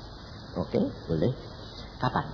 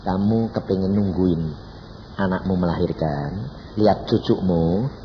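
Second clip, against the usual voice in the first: low, slow divine voice; slow, low-pitched offer; God speaking; 9 semitones lower than usual. Retold or imitated. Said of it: "Tuhan bilang".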